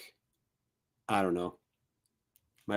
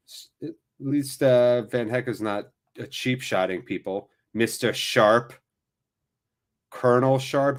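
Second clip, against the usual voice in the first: ordinary delivery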